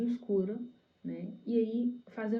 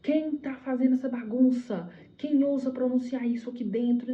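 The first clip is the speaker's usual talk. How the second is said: angry demand; 5 semitones higher, 5 dB louder than usual. Retold or imitated. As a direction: imitate an enraged leader confronting a crowd. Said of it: "porque ele se irou muito".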